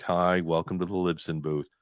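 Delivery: low, glum mock greeting; Eeyore. Greeting booth visitors as if dejected, low Eeyore voice. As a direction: drone it like a gloomy donkey. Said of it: "you would be like Eeyore".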